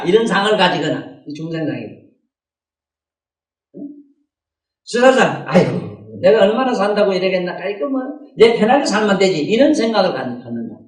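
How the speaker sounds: ordinary talk, nothing marked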